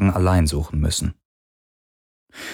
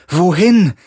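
high, loud call into the distance; shouted across the sea; 11 semitones higher, 9 dB louder than usual. A narrator's reading. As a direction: call out far over the water. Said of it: "rief Galen über das Meer". (laut übers Meer gerufen)

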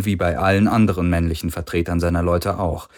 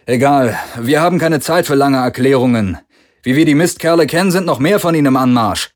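louder, fast, pressing; urgent Parker; 6 semitones higher, 6 dB louder than usual. A narrator's reading. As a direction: hurry them, danger coming. Parker urges haste.

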